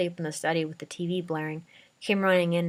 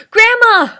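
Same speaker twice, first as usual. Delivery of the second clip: piercing high scream; 19 dB louder than usual. A narrator's reading. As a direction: scream her name in panic. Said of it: "I cried".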